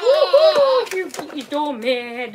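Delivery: put-on playful baby talk.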